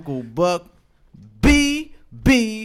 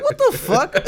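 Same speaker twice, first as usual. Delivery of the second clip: shocked squeal. Retold or imitated. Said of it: "I'm like".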